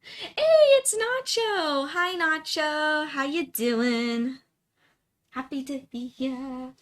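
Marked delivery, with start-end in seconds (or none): none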